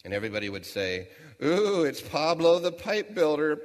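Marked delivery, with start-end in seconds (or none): 1.4-3.6 high, loud, slow teasing call; mocking sing-song. Townsfolk jeering at Pablo, high mocking sing-song. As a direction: sneer it out like a playground taunt.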